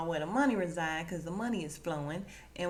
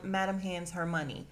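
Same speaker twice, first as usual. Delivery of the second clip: usual speaking voice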